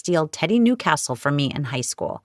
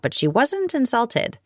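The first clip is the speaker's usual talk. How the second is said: ordinary delivery, nothing marked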